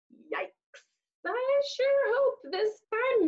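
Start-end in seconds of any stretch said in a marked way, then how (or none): 0.1-0.8 whispered worried yikes. Acted. Reader whispers alarm to the child. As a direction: breathe it out nervously.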